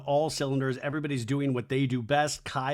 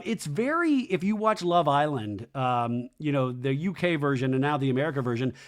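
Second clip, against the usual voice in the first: normal talking voice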